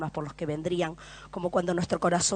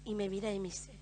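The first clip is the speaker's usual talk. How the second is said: normal talking voice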